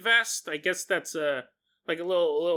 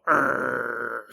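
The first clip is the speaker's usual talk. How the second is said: low growl; 7 semitones lower than usual. Shown as a demonstration. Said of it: "they probably mostly go".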